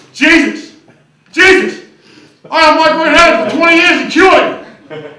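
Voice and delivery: loud, high ecstatic yelling; healed and overjoyed, yelling thanks; joyful shout. Acted. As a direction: shout for joy at the miracle.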